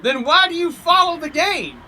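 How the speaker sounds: high-pitched, puzzled question; narrator's character lifting into wonder. A narrator's reading. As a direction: ask it in sheer bafflement. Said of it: "I ask in wonder".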